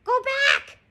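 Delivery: very high, loud parrot voice; parrot squawk. A narrator's reading. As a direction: screech it like a warning bird. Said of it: "squawked Polly".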